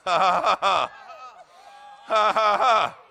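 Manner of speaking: loud, measured "ha, ha, ha"; deliberate laugh. Shown as a demonstration. Preacher showing the congregation how to practise laughing, deliberate laugh. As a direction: force out each ha loudly. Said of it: "Practice laughing for a few minutes".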